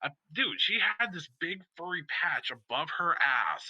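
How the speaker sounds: ordinary delivery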